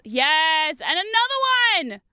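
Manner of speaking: loud, drawn-out whoop of triumph; real excited cheer. Real, not acted. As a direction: celebrate a lucky find at full voice. Finding another shard in the game.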